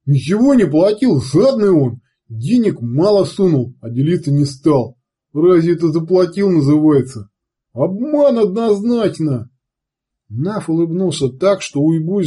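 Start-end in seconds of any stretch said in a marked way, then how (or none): 0.1-9.5 loud, blustering thug pleading innocence; a rough savage swearing he's innocent; wheedling brute. A narrator's reading. Уйбуй жалуется на жадность.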